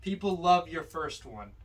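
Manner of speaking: ordinary talk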